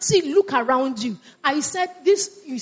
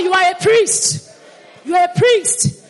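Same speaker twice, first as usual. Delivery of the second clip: loud, high preaching shout; rousing declaration; 5 semitones higher, 5 dB louder than usual. Real, not acted. Preacher telling congregation who they are, shouted declaration. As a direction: proclaim it forcefully to the room.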